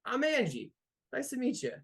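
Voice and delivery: high, eager voice introducing herself; bubbly giantess. Acted. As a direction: chirp your name out, way up high.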